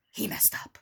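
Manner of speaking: whispered. Acted. Reading the tweet aloud to viewers, whispering its end. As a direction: whisper the quote's last words.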